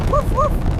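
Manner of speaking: high, loud excited barks; dog barking. Acted. The dog barking loudly while fleeing the fire. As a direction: bark like a frantic puppy.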